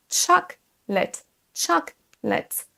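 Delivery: "Chocolate" slowly, CHOK high, lət dropped; stress on first syllable. Shown as a demonstration. Teacher showing the accent, "Chocolate" stressed on high CHOK. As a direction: punch CHOK in "Chocolate", pause, light lət. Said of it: "accentuer la première syllabe".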